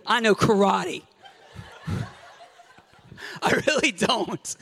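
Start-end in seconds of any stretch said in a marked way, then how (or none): 1.9-4.6 slow, laughing confession; laughing admission. Real, not acted. Preacher admitting to congregation he knows no karate. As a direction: giggle as you give it up. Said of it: "You think I'm being silly".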